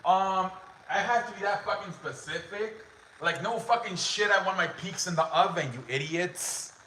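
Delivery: mock the clueless shop under her breath; a hushed, disgusted rant; annoyed sister. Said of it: "my sister's like".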